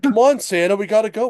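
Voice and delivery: an eager little elf pushing Santa along; mock urgent. Elf hurrying Santa into the sleigh, excited mock urgency.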